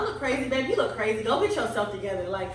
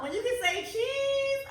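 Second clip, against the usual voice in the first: ordinary talk, nothing marked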